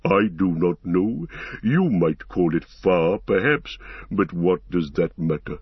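put-on deliberate old tree-creature with swinging tune; stately giant, untroubled; Treebeard, unconcerned. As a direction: ponder the question aloud at leisure.

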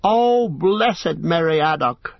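slow, high, delighted exclamation; grateful cry. A narrator's reading. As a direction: savour the thanks, drawn out. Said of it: "cried Pippin".

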